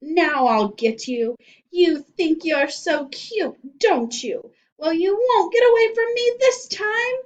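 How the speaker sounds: menacing witch. Acted. Witch Missus Gorf threatening the two boys, screeching. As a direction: gloat then shriek at them.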